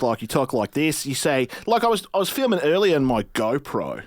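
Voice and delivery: put-on Aussie accent, slightly lifted pitch; Australian accent. Shown as a demonstration. Showing the host his accent, Australian. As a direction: demonstrate an Australian accent with long vowels. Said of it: "Australian accent".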